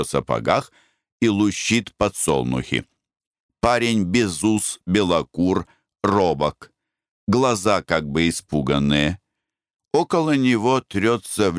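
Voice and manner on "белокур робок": ordinary delivery, nothing marked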